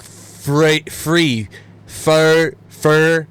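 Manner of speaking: loud, isolated "free" and "fur" with hard F starts. Shown as a demonstration. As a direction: demonstrate "free" and "fur" as English sayings of three and thir-. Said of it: "It's fur, not f".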